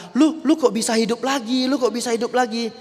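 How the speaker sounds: high, loud, startled cry of disbelief; astonished. Retold or imitated. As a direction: gasp it out as if seeing a ghost. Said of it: "wah semuanya terkejut". (teriakan kaget yang tinggi dan keras)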